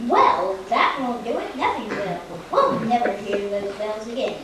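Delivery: usual speaking voice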